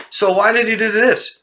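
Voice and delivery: high, loud parroting; mocking a clueless asker. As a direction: repeat the dense question, mocking. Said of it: "And they'll go".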